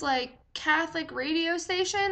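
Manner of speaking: usual speaking voice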